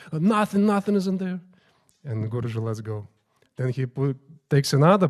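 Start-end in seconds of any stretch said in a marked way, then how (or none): 0.1-1.4 high, quick frightened reply; scared reply. Retold or imitated. Scared priest answering the squeezing guru, high and hurried. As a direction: imitate a priest caught off guard, pitch raised. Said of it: "the pujari is scared".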